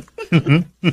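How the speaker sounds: short teasing giggle; playful giggle. Acted. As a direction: giggle playfully at your wife. Flirty teasing between husband and wife. (pilyang hagikgik)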